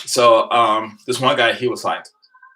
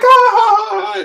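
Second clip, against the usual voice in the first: high shrieking laugh; loud squealing laugh at a friend; burst of laughter; 9 dB louder than usual. Retold or imitated. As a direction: let out a sudden loud cackle. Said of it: "I'm like".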